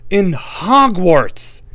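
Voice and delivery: high, loud outraged cry; outraged shout. A narrator's reading. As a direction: shout it in disbelief. Harry's outrage rising.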